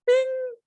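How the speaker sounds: high, bright ding of an idea; light bulb bing. Retold or imitated. Salesman marking his idea with a high bing. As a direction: squeak it like a bell going off. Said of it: "a light bulb's on".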